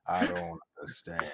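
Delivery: low soft growl; Vin Diesel voice. Pretend. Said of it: "Vin Diesel voice".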